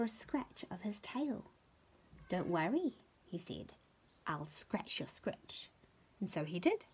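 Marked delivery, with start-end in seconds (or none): 2.3-5.7 quiet hedgehog. Acted. Hedgehog quietly promises to scratch, low and soft. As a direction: murmur the promise gently.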